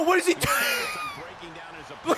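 high, drawn-out disbelieving shriek; shocked squeal. Real, not acted. Streamer watching a wild wrestling move, shrieking in disbelief.

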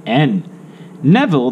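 plain reading voice

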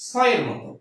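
স as a sharp hissed English s; dental s. Shown as a demonstration. Teacher showing the first of two sounds, স as dental s. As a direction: sound স with a crisp s, tongue at the teeth. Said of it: "দন্ত স এর উচ্চারণ হচ্ছে".

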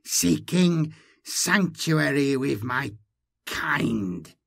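slow, inhuman creature voice; slow distorted hiss; Malindi, hissed. A narrator's reading. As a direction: drag it out like a twisted-tongued alien. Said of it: "Malindi hissed".

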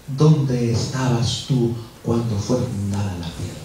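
low, slow solemn question; God questioning Job. Acted. (voz de Dios, grave y pausada)

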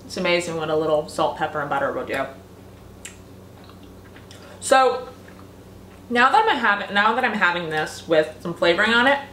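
talking while chewing pasta; muffled chewy speech; mouth full. Real, not acted. Seen 1: Eats pasta forkful before; chewing visible throughout, swallows near end.

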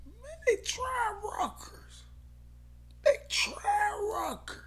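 very high, slow, half-whispered whine; whiny falsetto. Real, not acted. Bettor whining that they're trying Rutgers, high falsetto. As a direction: moan it in falsetto disbelief. Dismay over Rutgers pick.

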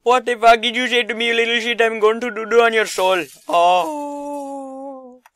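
put-on high, loud comic accented taunting; mock-threatening character. Gamer taunting little targets he will bap, mock-menacing and loud. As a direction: taunt them in a hammed-up high voice.

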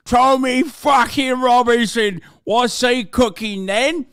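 put-on high, loud, slow British lad accent; mock British accent. Streamer mocking a British nationalist, in a British accent. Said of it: "Why are you so good with that accent?".

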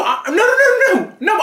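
ordinary delivery

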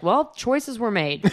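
slow, drawn-out dry put-down; wry slow verdict. Retold or imitated. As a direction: savor the backhanded compliment slowly. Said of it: "my one note about it was like".

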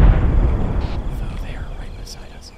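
hushed whisper, enemy close. Real, not acted. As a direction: breathe the words, barely voiced. Enemies right beside them.

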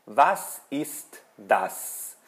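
very slow, each word apart; deliberate teacher's question for learners; model question. Shown as a demonstration. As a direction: repeat "Was ist das?" word by word, ending downward. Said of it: "eu pergunto".

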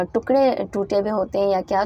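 normal talking voice

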